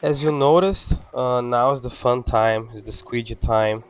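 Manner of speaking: ordinary talk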